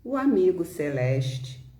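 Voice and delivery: normal talking voice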